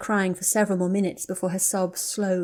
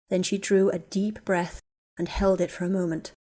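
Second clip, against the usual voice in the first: plain reading voice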